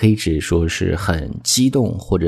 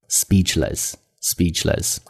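slow, careful English word for learners; slow model reading; 5 dB quieter, much slower than usual. Shown as a demonstration. Teacher repeats the new word for learners, slow and careful. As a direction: say it slowly, twice, for the class.

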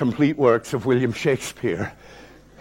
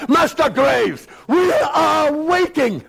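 high shouted summons, dragged out slowly; impatient shout; 12 semitones higher, 4 dB louder, slower than usual. Retold or imitated. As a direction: bark the name to hurry him. Headmaster calling boy to read.

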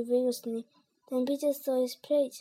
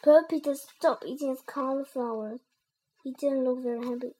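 ordinary delivery, nothing marked

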